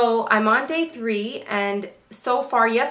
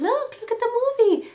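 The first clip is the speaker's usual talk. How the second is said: put-on much higher, bright sing-song to a toddler; distracting mom voice; 13 semitones higher than usual. Mother distracting her toddler toward the movie, high sing-song. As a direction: steer the child's attention away cheerfully. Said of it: "and I said".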